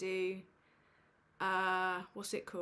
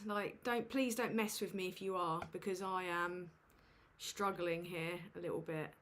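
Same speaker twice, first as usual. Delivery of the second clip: ordinary talk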